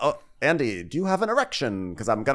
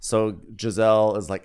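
normal talking voice

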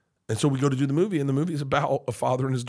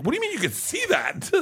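very high, incredulous question; indignant disbelief. Retold or imitated. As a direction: squeal it in comic outrage. Dad's indignant reaction to daughter.

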